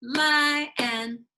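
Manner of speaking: "Lion" called out high and loud in two claps; clapped syllables. Shown as a demonstration. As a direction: clap "Lion" into two beats. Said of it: "Get our hands ready".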